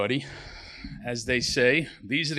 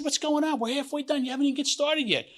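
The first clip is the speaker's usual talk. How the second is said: exasperated devil; 13 semitones higher than usual. Acted. Devil complaining to God, joke.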